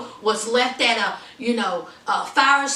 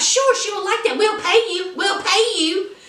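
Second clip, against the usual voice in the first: eager Elena; 8 semitones higher than usual. Retold or imitated.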